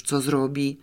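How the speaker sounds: ordinary delivery, nothing marked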